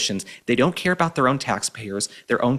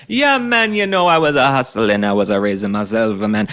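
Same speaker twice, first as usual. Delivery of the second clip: hammed-up Jamaican accent; 6 dB louder than usual. Commentator mocks governor's hustling story in an exaggerated Jamaican accent. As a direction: ham up a cartoon Jamaican "yeah, man". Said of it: "He's, like, kinda this Jamaican guy".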